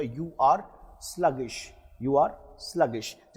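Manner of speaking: slow dictation. Shown as a demonstration. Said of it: "teacher models sentence for practice".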